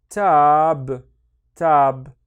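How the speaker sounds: TAB loud and drawn out on its own; loud teaching demo of "tab" with a long vowel; isolated syllable. Shown as a demonstration. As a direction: sound out "tab" as one long, firm syllable. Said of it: "the second one on its own".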